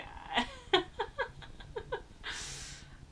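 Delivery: high-pitched helpless laugh; squealing laugh. Real, not acted. Laughing at old video.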